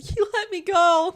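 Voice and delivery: lightly mocked teary voice; imitating her friend. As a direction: mimic her tearful relief. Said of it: "And she was like".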